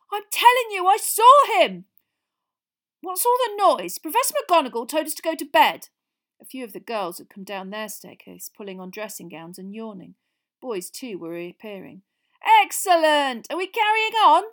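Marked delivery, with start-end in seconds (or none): 0.1-1.9 insistent shout. Acted. Ron insisting excitedly. 3.0-5.9 high, loud complaint; sleepy girl demanding answers; annoyed girl. Acted. Girl asks about the noise. 12.4-14.4 high, loud, gleeful cheer; bright and eager. Acted. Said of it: "said Fred Weasley brightly".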